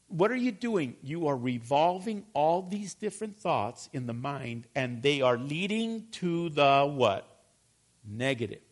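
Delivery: ordinary talk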